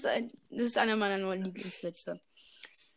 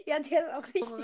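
laughing; 5 semitones higher, faster than usual. Real, not acted. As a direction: burst of high laughter. Laughing at the pun.

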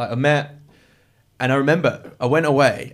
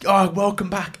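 cheery welcome; 9 semitones higher than usual. Retold or imitated. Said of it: "Mills was like".

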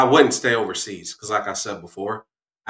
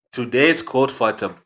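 put-on African accent; 2 semitones higher, 4 dB louder than usual. Dad opens the day's quote, African accent. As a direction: announce it in a strong African accent. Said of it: "African accent".

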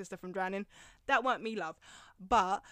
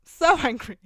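laughing, louder So angry; laughing outburst; 4 semitones higher, 12 dB louder than usual. Real, not acted. Ranting about the ugly teacher, laughing and loud. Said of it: "I'm so angry".

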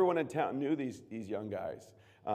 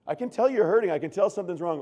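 pleading parent; 5 semitones higher, 7 dB louder, faster than usual. Acted. Parent pressing teenager.

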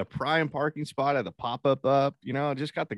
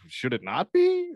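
mock innocent; 11 semitones higher, 4 dB louder, slower than usual. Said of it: "I'm like".

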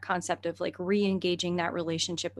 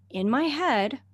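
ordinary delivery

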